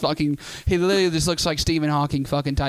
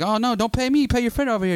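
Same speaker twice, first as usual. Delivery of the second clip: higher-pitched mock indignation; agitated, raised-pitch retort; indignant driver; 8 semitones higher than usual. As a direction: imitate a fed-up driver scolding. Angry driver refusing Tyler's payment.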